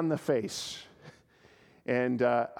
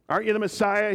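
mocking, raised and louder; sarcastic taunt; 7 semitones higher, 6 dB louder, faster than usual. Criminal on a cross mocking Jesus, sarcastic. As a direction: sneer it like a jeering criminal. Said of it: "he says sarcastically".

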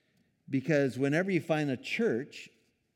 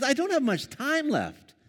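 exasperated Jesus; 11 semitones higher, 6 dB louder than usual. Retold or imitated. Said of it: "Jesus knows, he says".

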